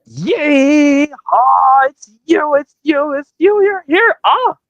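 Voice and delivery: high squealing excitement; ecstatic. Acted. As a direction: squeal with joy. Acted Toy Story scene.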